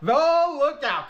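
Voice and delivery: playful alarm, starting high; mock warning. Host warning viewers Cartoon Wife is on, mock alarm.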